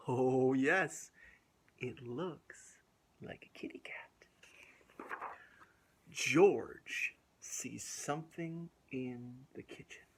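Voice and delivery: lively storybook reading with gasps; reading to children. A narrator's reading. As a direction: read it aloud to little kids. Reads picture book to children.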